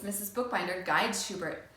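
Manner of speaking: ordinary delivery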